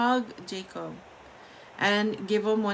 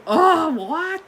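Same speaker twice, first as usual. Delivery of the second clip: surprised; 8 semitones higher, 9 dB louder than usual. Retold or imitated. Mock reaction to the trick.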